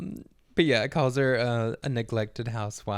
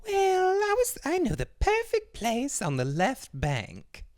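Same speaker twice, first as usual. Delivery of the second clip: haughty Andora. Retold or imitated. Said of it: "And Andor's like".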